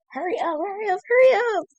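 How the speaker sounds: high frantic cries of hurry up; screaming in terror to hurry; panicked yelling. Real, not acted. Panicked yelling at fleeing teammate.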